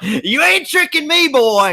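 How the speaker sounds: high, mocking tough-guy voice; squeaky put-on voice of a cocky suspect. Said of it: "And he's like".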